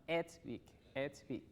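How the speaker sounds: "It's" opened to "ets"; corrected pronunciation. Shown as a demonstration.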